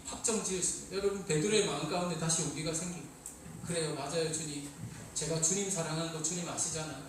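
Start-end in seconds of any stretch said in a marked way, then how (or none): 3.7-7.0 sincere confession. Acted. Peter answers Jesus, renewed.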